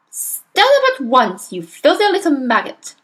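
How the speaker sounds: irate headmistress; angry command. A narrator's reading. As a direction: order a pupil up with contempt. Headmistress ordering and insulting pupil.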